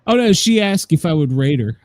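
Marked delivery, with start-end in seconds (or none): none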